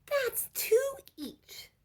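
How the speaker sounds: very high, slow whimpering whisper; crying child. A narrator's reading. Children count two each, whimpering high and slow. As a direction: whimper it as the cookies dwindle.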